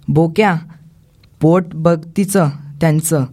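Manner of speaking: usual speaking voice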